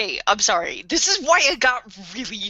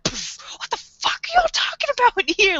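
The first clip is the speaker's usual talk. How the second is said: high, incredulous rant; worked-up, high-pitched indignation; mock outrage; 11 semitones higher than usual. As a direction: demand answers in exasperated disbelief. Imagined outraged confrontation, really mad.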